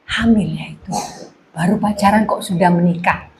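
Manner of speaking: low, hushed gossiping aside; murmured nosy remark. Acted.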